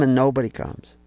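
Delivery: normal talking voice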